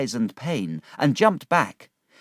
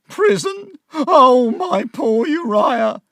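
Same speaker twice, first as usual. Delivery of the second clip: much higher, louder, wailing mother; wailing cry; 13 semitones higher, 8 dB louder, slower than usual. A narrator's reading. Missus Heap wailing over her son going to prison. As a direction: wail in despair for your son. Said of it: "Missus Heap cried".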